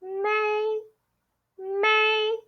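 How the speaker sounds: MAY held long on one high, level pitch; high first tone. Shown as a demonstration. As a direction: sustain 'May' high without rising or falling. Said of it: "that is the tone we're gonna use".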